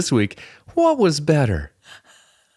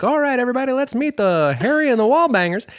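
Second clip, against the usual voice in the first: much higher, peppy host announcing; game-show host voice; faster than usual. Retold or imitated. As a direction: introduce the family like a TV host. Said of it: "Mark Summers would have come over and be like".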